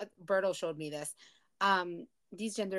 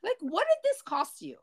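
high, disbelieving demand; incredulous; 13 semitones higher, 3 dB louder than usual. Real, not acted. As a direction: ask it in outraged disbelief. Outraged at the couple.

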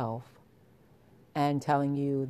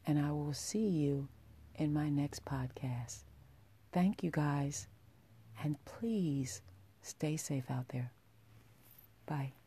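soft whispered goodbye; whispering; 6 dB quieter than usual. Real, not acted. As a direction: breathe the words out gently. Whispered podcast sign-off.

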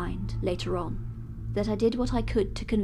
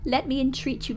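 much higher and louder, begging with tears, slowing to a sob at the end; tearful desperate pleading; 11 semitones higher, 10 dB louder than usual. Acted. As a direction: beg for your soul in a torrent of tears. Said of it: "with the tears rolling down his cheeks".

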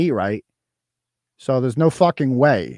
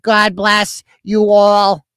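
high, loud, drawn-out mocking sign-off; Newport Lights accent; 7 semitones higher, 5 dB louder, much slower than usual. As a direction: stretch it out loudly in the put-on begging mom voice. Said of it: "She clearly speaks in, like, the Newport Lights accent".

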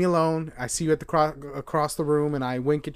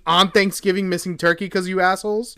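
loud, raised-pitch aggrieved outburst; indignant rant; 6 semitones higher, 10 dB louder than usual. Retold or imitated. Worker who missed Thanksgiving dinners scolds stores, indignant rant. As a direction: complain in mock outrage about lost holidays. Ranting about cancelled retail holiday.

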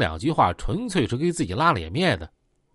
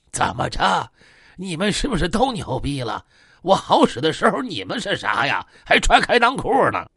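indignant, raised-pitch challenge in an altered voice; worked-up; 4 semitones higher than usual. Acted. Old gangster confronts the men who disrespect him, worked up. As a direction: fire off worked-up questions, climbing at the end. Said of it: "老棍子这个时候有点上头了".

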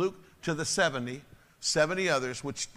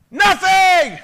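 much higher, much louder, sustained shout; preaching shout; 17 dB louder than usual. Real, not acted. Pastor proclaims scripture to the congregation, full shout. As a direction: blast the word out to the hall.

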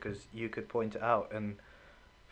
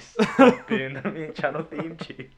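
laughing; 2 semitones higher, 6 dB louder than usual. Real, not acted. Laughs while teasing.

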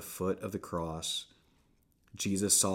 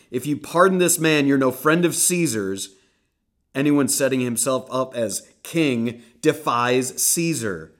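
loud, much higher threat; shouting down; 6 semitones higher, 11 dB louder than usual. Acted. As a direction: drown him out with the threat.

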